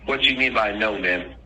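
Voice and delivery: ordinary talk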